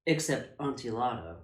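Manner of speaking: plain reading voice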